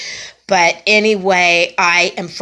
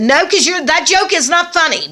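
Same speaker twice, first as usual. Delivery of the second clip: much higher, louder fed-up no; exasperated refusal; 11 semitones higher, 4 dB louder than usual. Retold or imitated. Refusing to hear the old stupid joke again, exasperated. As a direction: brush off a stale joke, annoyed. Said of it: "normally I would have said".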